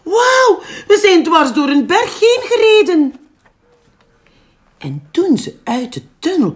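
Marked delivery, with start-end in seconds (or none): 0.0-3.1 high, loud, laughing cry; shrieking with joy. Acted. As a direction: squeal it out in delight. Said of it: "gilde hij".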